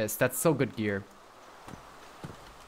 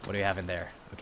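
a lower, softer menacing robber voice; low tough-guy voice; 8 semitones lower, 9 dB quieter, much faster than usual. Acted. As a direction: play the stickup man, low and quiet. Robbing a player at gunpoint.

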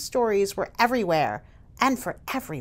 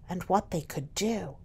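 whisper; 2 semitones lower, 7 dB quieter than usual. A narrator's reading. Hushed end of climax.

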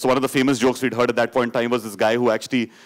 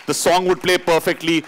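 ordinary delivery

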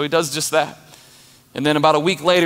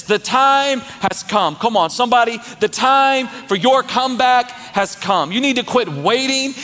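full-force preaching; 8 semitones higher than usual. Acted. Pastor rallying the congregation, preaching at full force. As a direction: preach it hard to the crowd.

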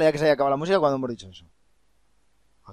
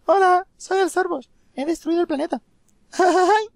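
very high squeaky cartoon voice; 7 dB louder than usual. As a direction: squeak it out like a tiny creature. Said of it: "el Sorbos en realidad tiene voz de pito".

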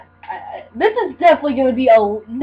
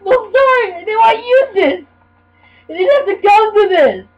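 high, wailing mock apology; mock-dramatic pleading; 5 semitones higher than usual. Gamer apologising for his desperate choice, mock-dramatic wail.

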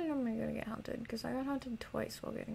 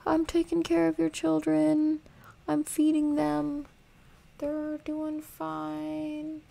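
loud, higher, pleading; a frantic plea to the monster Mama; mock pleading; 4 semitones higher, 9 dB louder, slower than usual. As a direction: beg the monster mother.

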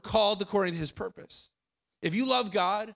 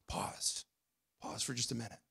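hushed pause; 10 semitones lower, 15 dB quieter than usual. Shown as a demonstration. Preacher hushing the congregation to stop, near-whispered. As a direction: drop to a breathy near-whisper.

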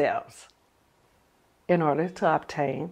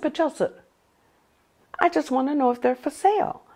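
sweet pleading; 9 semitones higher, faster than usual. Retold or imitated. Wife pleading with husband.

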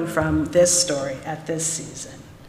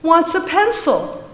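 mocking teacher; 11 semitones higher, 4 dB louder than usual. A narrator's reading. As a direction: tease him in front of the class, pitched high. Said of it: "with a grin on her face".

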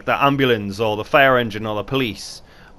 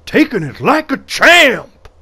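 hyped cheer; 10 semitones higher, 9 dB louder, slower than usual. Real, not acted. Cheering on the player.

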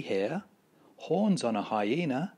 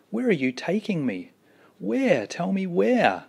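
higher, louder, anxious cries of "where"; frightened; 9 semitones higher, 6 dB louder than usual. Acted. Cave Baby carried off at night, high frightened calls. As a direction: panicky child calling out.

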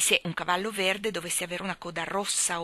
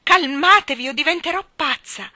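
loud, high exasperated cry; desperate; 9 semitones higher, 11 dB louder than usual. A narrator's reading. Mother at her wits' end with the children, desperate. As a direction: burst out at full voice. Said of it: "si torceva le mani per la disperazione".

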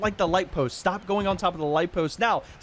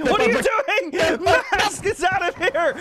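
high, stretched-out, laughing outcry; laughing disbelief; 10 semitones higher, 3 dB louder, slower than usual. Real, not acted. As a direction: crack up while calling out in disbelief. Laughing at a player being eliminated.

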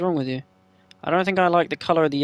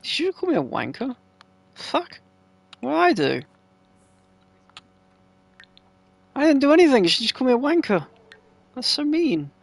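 mock outrage; 5 semitones higher than usual. Real, not acted. Sim calls her a wanker.

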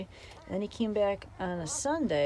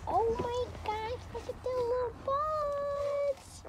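high, slow cooing; slower than usual. Real, not acted. Cooing at the newborn puppies, high and slow. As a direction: coo over the tiny puppies.

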